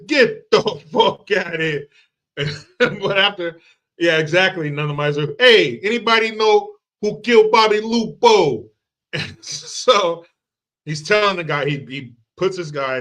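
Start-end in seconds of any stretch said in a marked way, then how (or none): 0.1-1.9 loud, stretched bad Italian accent; Steven Seagal. Detective telling a mobster a story, bad Italian accent. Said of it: "probably the worst Italian accent ever". 5.4-8.7 high, loud bad Italian accent; Steven Seagal. Detective asking around about a killing, bad Italian accent. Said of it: "He is the worst Italian accent".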